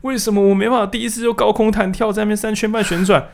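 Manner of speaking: high-pitched mock wailing. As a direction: imitate someone crying over an absurd failure, far above your normal pitch. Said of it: "然后你在那边哭说". (夸张地哭诉，音调很高)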